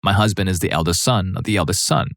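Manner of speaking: ordinary delivery, nothing marked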